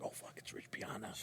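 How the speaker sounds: retold starstruck whisper; awed whisper. Fan to himself on recognizing his idol, awed whisper. Starstruck aside on seeing him.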